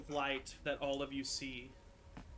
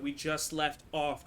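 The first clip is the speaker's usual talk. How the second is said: ordinary delivery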